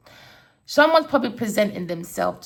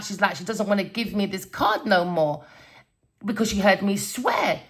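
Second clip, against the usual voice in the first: ordinary delivery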